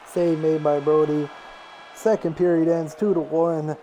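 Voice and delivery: steady running hockey call, picking up on shots; sportscaster calling the puck; play-by-play commentary. Acted. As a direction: call the game like a TV announcer.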